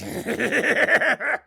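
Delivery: loud booming cackle; big gang laugh; monster laughter. Acted.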